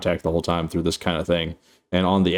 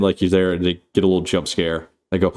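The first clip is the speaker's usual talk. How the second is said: ordinary talk, nothing marked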